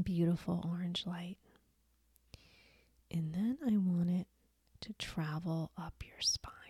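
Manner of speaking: soft, soothing meditation voice, long pauses; guided meditation. Acted. Guide leading listeners through healing creativity blocks, soft meditation voice.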